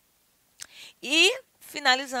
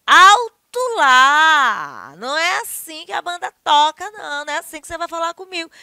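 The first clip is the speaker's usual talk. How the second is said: scolding in an argument; 6 semitones higher, 12 dB louder than usual. Shown as a demonstration. Teacher acts an argument, loud scolding. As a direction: stop someone cold in a quarrel. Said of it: "Em discussões também".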